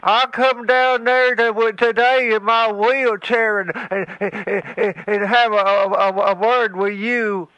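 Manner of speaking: put-on a crotchety old man who stammers on every word. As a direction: stutter and sputter like an angry old hillbilly. Stuttering threat.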